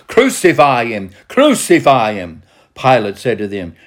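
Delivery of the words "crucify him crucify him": raised, higher crowd cry. A narrator's reading. Chief priests and officers demanding crucifixion, crying out. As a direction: call it out like a demanding mob. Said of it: "they cried out".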